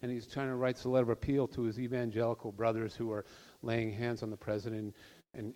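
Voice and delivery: normal talking voice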